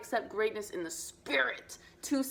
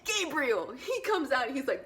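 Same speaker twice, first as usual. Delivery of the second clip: normal talking voice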